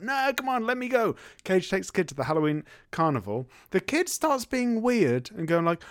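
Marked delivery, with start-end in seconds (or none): 0.0-1.1 put-on high whiny child begging; mock kid nagging his mum; pleading kid. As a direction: plead like a kid denied a treat. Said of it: "It's like".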